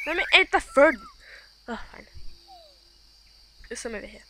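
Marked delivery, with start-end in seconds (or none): 0.1-1.0 high, loud demand. Real, not acted. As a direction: insist loudly on food. Fighting for food.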